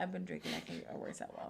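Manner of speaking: soft confession fading into a whisper; hushed whisper. Real, not acted. Host admitting to viewers she's been drinking, hushed. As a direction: confess it under your breath, just audible. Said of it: "Why are you whispering it?".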